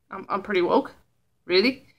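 low, hushed questioning echo; quiet disbelief. Real, not acted. Quiz taker doubting the verdict just read, quietly incredulous. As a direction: repeat it softly, stumbling, as a doubtful question.

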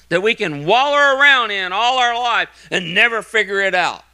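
fervent sermon delivery building to a hallelujah; full-force preaching. Acted. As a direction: preach it out to the whole congregation.

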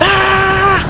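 loud, high "aah" of alarm; startled scream. Real, not acted. Player caught in a game sandstorm, startled scream. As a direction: scream as it hits you.

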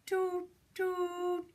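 high train whistle imitation; two even, high toots, the second held longer. Shown as a demonstration. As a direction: toot like a little train. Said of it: "Nice train sound".

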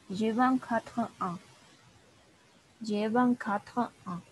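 "Je vingt-quatre ans" stretched out very slowly, nasal vingt; slow it right down so she can copy it; very slow French model phrase. Shown as a demonstration. Teacher repeats age phrase slowly for struggling student. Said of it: "en cómo lo voy a pronunciar yo".

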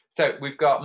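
usual speaking voice

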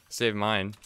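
ordinary talk, nothing marked

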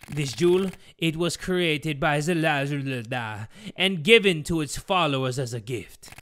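muddled put-on accent, ominous telling; villain father reciting dark lore; hammed-up cult history in a made-up accent; villainous Geppetto, storytelling. Geppetto tells the cult's history.

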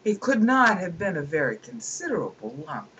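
normal talking voice

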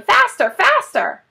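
high, loud frenzied urging; excited shouting. Acted. Boggis shouting wildly at the other farmers. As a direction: whip them on like a madman. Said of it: "and shouting".

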